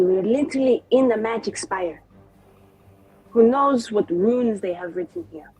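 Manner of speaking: ordinary talk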